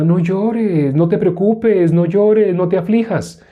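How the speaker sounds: high, run-on singsong comfort; hammed-up reassurance, stretched endings; mock hollow consoling. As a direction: imitate someone fussing with empty sympathy. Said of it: "Decirle a una persona".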